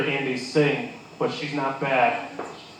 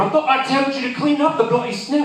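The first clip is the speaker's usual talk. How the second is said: high, louder British scolding; exasperated British accent; 11 semitones higher, 4 dB louder than usual. Acted. Boss scolds worker hours later, high British accent. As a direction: scold him in British English. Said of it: "an excuse for me to do an accent".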